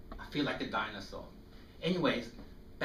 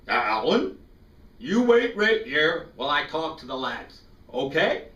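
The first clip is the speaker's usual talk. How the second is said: louder, gentle commanding priest; 3 semitones higher, 10 dB louder than usual. Retold or imitated. Priest telling Alan to wait, louder fatherly voice. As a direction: speak as the kind priest instructing the boy. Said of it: "and he says".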